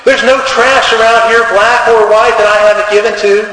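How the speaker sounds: a wronged church lady's rising fury; angrier. Retold or imitated. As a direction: complain to God, voice climbing high. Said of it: "She gets angrier".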